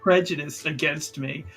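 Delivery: normal talking voice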